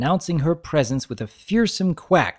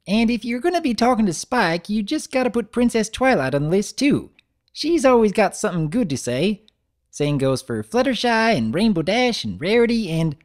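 lightly voiced Big Mac; 9 semitones higher, 3 dB louder than usual. Big Mac chatting to Apple Bloom, a lightly voiced farm stallion.

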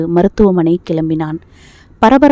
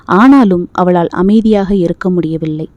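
plain reading voice

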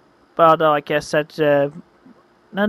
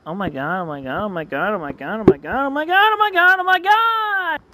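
mounting excitement. Acted. Storyteller before meeting a girl in fifteen minutes, mounting excitement. Panicking joy before the date.

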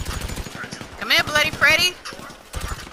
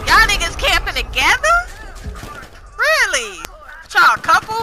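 incredulous mock; 8 semitones higher than usual. Real, not acted. Mocking rivals camping together.

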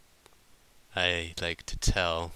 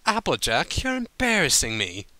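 higher, clipped prim rebuke; curt; 6 semitones higher, 5 dB louder than usual. A narrator's reading. Rarity rebuking drunk Applejack, curt and embarrassed. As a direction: snap at her properly. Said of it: "Rarity said curtly".

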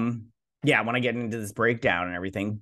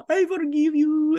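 very high, louder falsetto sing-song; mock sweet forgiveness; 5 dB louder than usual. Vlogger mocking the villain's fake forgiveness, high sing-song. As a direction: coo it sickly sweet and fake.

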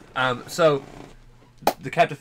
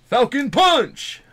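high, drawn-out battle cry; Captain Falcon; 11 dB louder, much slower than usual. Acted. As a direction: bellow it like a game hero's special attack. Mimics game character's attack catchphrase.

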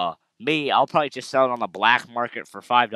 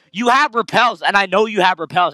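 louder higher angry rant; 7 semitones higher, 6 dB louder than usual. Real, not acted. Ranting at game character.

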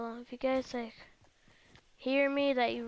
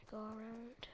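much softer, hushed voice; 3 semitones lower, 17 dB quieter, much faster than usual. Real, not acted. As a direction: murmur it to yourself. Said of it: "I'm gonna be quiet for this moment".

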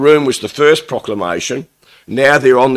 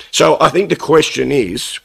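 normal talking voice

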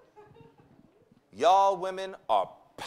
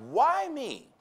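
high, loud mock whine; complaining woman; 10 semitones higher, 6 dB louder than usual. Preacher echoing the women's complaint, high mock whine. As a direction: playfully mimic an indignant sister. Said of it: "women often ask".

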